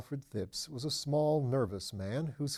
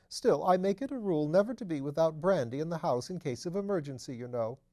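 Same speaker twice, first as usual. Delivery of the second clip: higher, louder fussy nervous voice; nervous little man; 8 semitones higher, 6 dB louder than usual. A narrator's reading. Nervous Phipps fussing to his lordship, higher and louder. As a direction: fret anxiously up in the voice.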